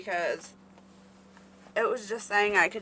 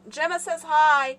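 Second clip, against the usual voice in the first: loud, high call to someone walking off; raised voice with a long hi; 7 semitones higher, 11 dB louder than usual. Real, not acted. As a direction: holler the greeting so it carries. Repeats the call after no answer.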